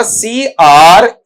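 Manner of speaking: slow "सीआरईसीएचई", one letter at a time; spelled out. Shown as a demonstration. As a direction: spell "सीआरईसीएचई" deliberately, each letter separate. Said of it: "एक बार spelling में पढ़ना चाहूंगा". ("सीआरईसीएचई" का हर अक्षर अलग-अलग, धीरे बोलें)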